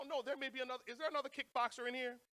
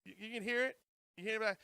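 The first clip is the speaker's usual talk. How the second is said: normal talking voice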